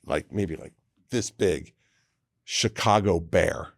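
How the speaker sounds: ordinary talk, nothing marked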